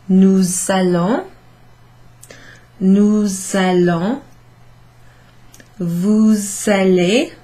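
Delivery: "nous allons" with the S said as a Z, slow; slow teacher drill of the liaison; liaison drilled slowly. Shown as a demonstration. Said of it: "where I said "nous allons," I did that little thing there".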